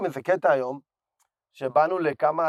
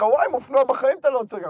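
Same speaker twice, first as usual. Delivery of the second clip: higher, quick amazed exclamation; retold amazement; 8 semitones higher, faster than usual. Telling his riding buddy about stopping mid-descent, amazed. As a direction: recreate a moment of wonder, rushed and raised.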